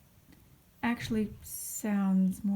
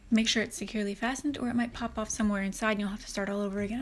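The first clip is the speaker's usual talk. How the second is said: als een veilingmeester; faster than usual. Real, not acted. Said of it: "talking like an auctioneer".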